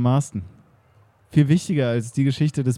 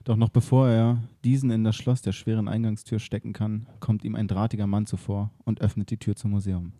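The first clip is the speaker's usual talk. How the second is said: radio play narration; 4 semitones lower than usual. A narrator's reading. Narrator setting the museum scene, low and hushed.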